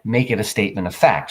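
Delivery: ordinary talk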